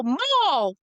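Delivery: son's blurt. Retold or imitated. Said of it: "And he would go".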